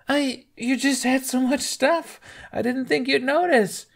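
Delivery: squirm out of it, caught red-handed; nervous excuse. Acted. Thief caught, making excuses.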